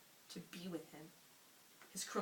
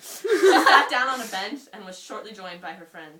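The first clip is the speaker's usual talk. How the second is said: laughing; 3 semitones higher, 17 dB louder than usual. Real, not acted. Reading own old story aloud.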